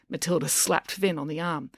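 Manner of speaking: usual speaking voice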